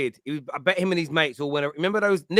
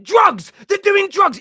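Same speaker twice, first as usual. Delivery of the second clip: shouting it much higher and louder; panicked shout; 11 dB louder, much slower than usual. Retold or imitated. Jesus panicking in the pub toilet, shouting high and loud. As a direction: yell it out in a panic. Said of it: "Started shouting".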